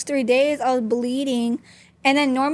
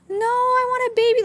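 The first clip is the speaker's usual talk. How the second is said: put-on high, pitched-up child voice protesting; mock child whine, much higher; whiny child; 11 semitones higher than usual. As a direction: do the little kid refusing to accept it. Kids protest about the baby.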